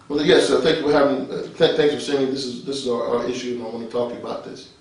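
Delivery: low, soft, polite formal talk; professional voice. Shown as a demonstration. Talking to Mister Senator about an issue, professional voice. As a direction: tone it right down, courteous and businesslike. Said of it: "your professional voice".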